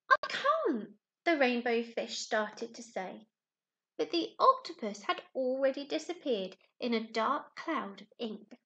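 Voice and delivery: lively storybook narration for little ones; animated picture book reading; reading a story to children. A narrator's reading. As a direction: tell it like a bedtime story to a class. Teacher reads picture book aloud.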